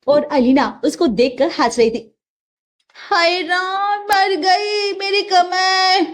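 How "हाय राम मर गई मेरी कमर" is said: higher, slow groan of pain; mock pain cry. Mother falls while mopping and moans about her back. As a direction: wail about a hurt back.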